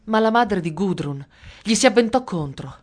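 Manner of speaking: ordinary delivery, nothing marked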